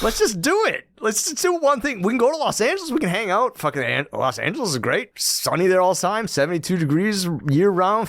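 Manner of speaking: high, excited sales pitch; eager pitch. Retold or imitated. Penny selling Desmond on Los Angeles, high and excited. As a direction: sell the trip, bright and pushy.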